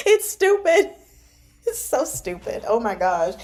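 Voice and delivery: real laughing outburst. Real, not acted. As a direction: laugh it out in disbelief. Laughing at joke.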